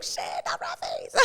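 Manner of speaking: very high, hushed squeal of dread; mock panicked squeal. Storyteller reliving spotting Rat Face, tiny mock squeal. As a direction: squeak it softly in dismay. Reenacts her dismayed reaction in story.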